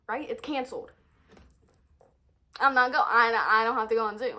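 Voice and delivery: normal talking voice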